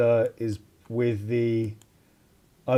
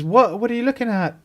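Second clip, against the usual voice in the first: high, sharp startled cry; 11 semitones higher, faster than usual. Acted. Celia caught out by the inspector's look, startled and high. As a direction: jump up in pitch as if caught. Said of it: "she goes wide-eyed. Says".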